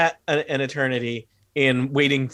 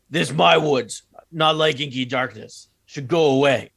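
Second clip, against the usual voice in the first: lightly voiced dumb ogre; slow, simple brute giving orders; dopey cartoon bellow; 2 semitones higher, 3 dB louder than usual.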